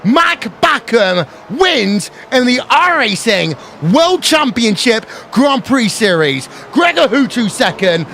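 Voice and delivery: excited race commentary, pitch climbing for the win; live play-by-play. Acted. Race announcer to viewers on the final lap, excited play-by-play.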